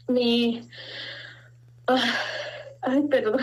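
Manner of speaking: real tears halting the words; choked up. Real, not acted. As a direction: break off, overcome, and apologize. Overcome, stops and apologizes.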